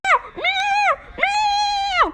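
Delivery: very high shouted meows; shouted kitty meows. Pretend. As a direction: yowl high like a panicked kitten. Kitty toy voiced.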